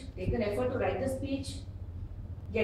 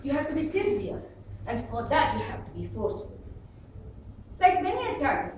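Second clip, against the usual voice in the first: normal talking voice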